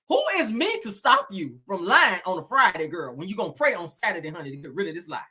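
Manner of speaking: ordinary talk, nothing marked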